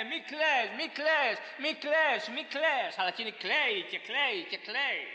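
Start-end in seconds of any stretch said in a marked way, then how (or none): 0.0-3.0 crowd comforting. Retold or imitated. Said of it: "ακούει μύριους γύρω να της λένε".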